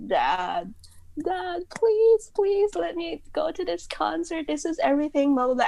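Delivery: high, pleading mock-tearful kid; dramatic begging. Teary daughter begging dad to let her go, high mock plea. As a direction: beg Dad like a desperate teen. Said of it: "My eyes were all teary".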